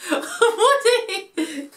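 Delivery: laughing while calling the dog; real laugh through a name; high laughing call; laughing scold. Real, not acted. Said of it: "Woody, don't eat that".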